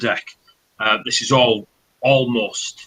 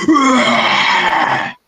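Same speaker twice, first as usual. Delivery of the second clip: long, loud high orc scream; orc roar; 12 semitones higher, 7 dB louder than usual. Acted. Orc stepping forward at the civvies, drawn-out screaming roar. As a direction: roar to scatter a crowd. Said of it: "the orc steps forward and goes".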